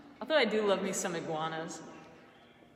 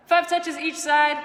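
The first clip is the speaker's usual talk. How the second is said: loud; 6 semitones higher, 10 dB louder than usual. Real, not acted. Instructor calling out the count.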